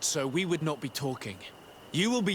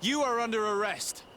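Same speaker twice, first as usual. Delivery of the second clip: higher, forceful arrest call; commanding shout; 8 semitones higher than usual. Acted. Legate confronts the captain and orders his arrest, shouting.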